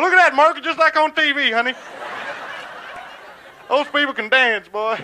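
high, loud gawking bus tourist; amazed tourist. Acted. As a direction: exclaim in wonder to a companion. Tourists on bus marvel at dancers.

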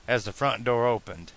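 ordinary delivery

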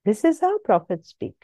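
usual speaking voice

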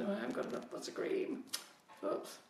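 a soft, breathy aside under her breath; half-whispered dismay at the empty bag; quiet aside. Real, not acted. Runs out of buttercream mid-demo.

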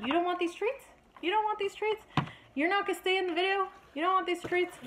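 high, sing-song coaxing; coaxing dog voice. Real, not acted. Owner coaxing her dog with treats, high baby talk.

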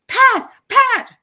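very high, loud screamed name; screaming name. Retold or imitated. Someone in danger screams for her, a very high loud shriek. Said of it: "somebody's screaming".

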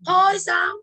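loud, high "Thôi sao?" like a stunned job candidate; shocked protest. Acted. Rejected candidate to interviewer, loud shocked disbelief.

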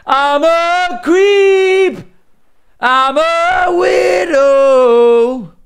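high, slow, hammed-up yell; mock outraged shout. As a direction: wail it out, dragging every word.

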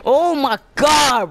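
high, loud outraged shouting; angry yelling. Real, not acted. Gamer yelling at the enemy who ran, angry shout. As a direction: yell insults in real outrage.

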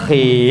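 "Khi" with rasped kh and long i, pitched low; kha with kasrah. Shown as a demonstration. Teacher demonstrating kasrah, low rasped long "Khi". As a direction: snore into a drawn low "Khi". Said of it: "Kasroh, kasroh".

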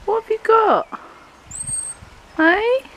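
high-pitched cooing question; animal-directed talk. Real, not acted. Asking an animal what it has got, high-pitched pet talk.

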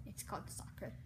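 whispering. Pretend. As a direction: hiss it back in a whisper. Arguing football versus soccer.

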